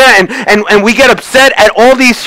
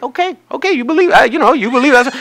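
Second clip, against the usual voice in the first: humouring; 4 semitones higher, 4 dB quieter than usual. Retold or imitated. Humouring the insistent friend.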